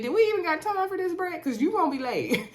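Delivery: quick, high, loud hurry-up call to the groom; mock exasperated call. Vlogger calls out to Brett about being late, high and hurried. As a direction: push him along like a worried friend.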